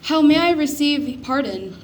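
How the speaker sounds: begging. A narrator's reading. Repentant thief begging Jesus for pardon, pleading. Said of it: "begged the repentant thief".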